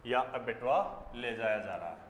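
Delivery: usual speaking voice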